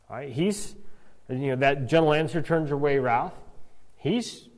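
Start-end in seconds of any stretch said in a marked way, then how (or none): none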